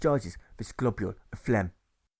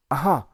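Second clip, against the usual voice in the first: yowled aha; 3 semitones higher, 7 dB louder than usual. Acted. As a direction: crow it like a pleased cat. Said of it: "yowls like a kitten".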